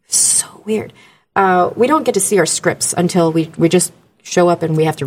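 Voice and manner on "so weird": soft, whispered disbelief; whispered aside; hushed aside. Real, not acted. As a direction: breathe it out after a sigh. Aside amid a strange story.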